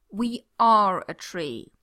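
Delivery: loud, slow and firm. A narrator's reading. Jack holding Yanto against the charging beast, loud and firm. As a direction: say it with total conviction. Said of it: "so firmly that Yanto".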